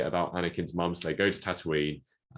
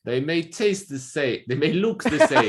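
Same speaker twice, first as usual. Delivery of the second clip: higher, louder put-on theatrical villain voice; Jared Leto voice; 11 semitones higher, 8 dB louder than usual. Unmasked Jar Jar turns out to be a higher, theatrical villain. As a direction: deliver it like a grand movie villain. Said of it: "he goes".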